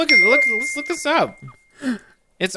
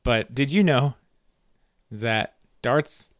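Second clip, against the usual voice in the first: ordinary delivery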